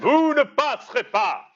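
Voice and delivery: booming high wizard roar; Gandalf shout. Acted. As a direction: bellow it like Gandalf barring the bridge. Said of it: "tu leur as pété trois tympans".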